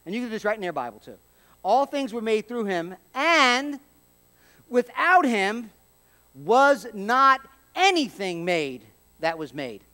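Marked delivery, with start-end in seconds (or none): 1.6-9.8 slow, louder, hammering each phrase; emphatic scripture read. Shown as a demonstration. Preacher reading to congregation, slow and emphatic. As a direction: drive the verse home slowly. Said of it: "That's hard for them to get around".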